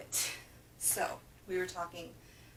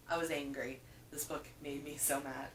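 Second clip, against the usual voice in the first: ordinary talk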